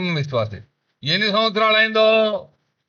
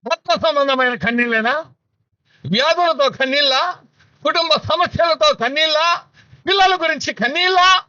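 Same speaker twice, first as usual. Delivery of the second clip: each question pitched higher than the last; climbing questions; 9 semitones higher, 3 dB louder than usual. Acted. List of questions rising. (క్రమంగా పెరిగే ప్రశ్నల స్వరం)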